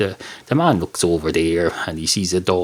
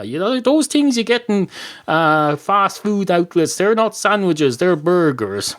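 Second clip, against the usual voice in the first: indignant correction; 8 semitones higher, 3 dB louder than usual. Acted. Dog setting the man straight on burgers, indignant. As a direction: insist on it, slowing on key words.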